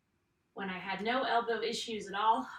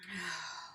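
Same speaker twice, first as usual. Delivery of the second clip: tired sigh; 3 semitones lower, 7 dB quieter than usual. Real, not acted. Tired sigh mid-workout.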